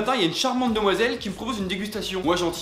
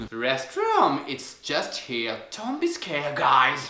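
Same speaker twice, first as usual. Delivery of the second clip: put-on low, soft, very slow accented English; creepy station attendant; 4 semitones lower, 3 dB quieter, much slower than usual. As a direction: play the sinister lone attendant, slow and hushed. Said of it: "la seule personne qui travaille te regarde du coin de l'œil". (pompiste inquiétante, voix basse et lente)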